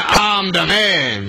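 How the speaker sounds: slow, drawn-out macho model line; put-on manly voice, stretched out; the boys' line. Shown as a demonstration. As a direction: lead the boys with a slow swaggering call. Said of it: "all the boys say".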